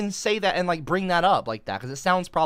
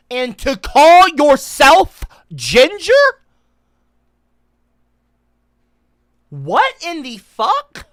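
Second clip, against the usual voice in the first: outraged disbelief; 9 semitones higher, 11 dB louder, much slower than usual. Real, not acted. Outraged rant about hair dye.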